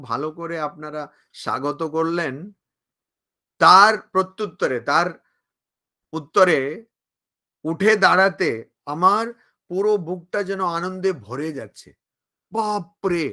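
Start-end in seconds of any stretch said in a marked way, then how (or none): none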